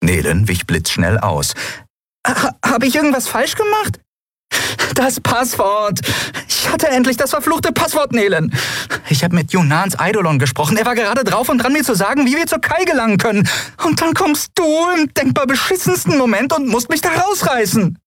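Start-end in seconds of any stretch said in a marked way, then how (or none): 2.3-4.0 very high, stammering little voice; worried tiny creature. A narrator's reading. Nelen asks whether she erred, high and stammering. 4.9-6.0 high, drawn-out wail of frustration; anguished outcry. A narrator's reading. Endriel wails to Nelen about what she lost, high and anguished. 6.5-18.0 very high, upset rant; exasperated tirade. A narrator's reading. Endriel blames Nelen for waking her, high and furious.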